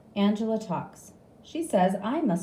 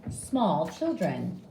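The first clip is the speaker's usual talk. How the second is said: ordinary delivery, nothing marked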